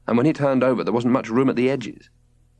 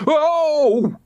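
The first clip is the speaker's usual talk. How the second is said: much higher and loud; screech; 4 dB louder than usual. A narrator's reading. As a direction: shriek on hitting the ground. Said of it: "screeched George".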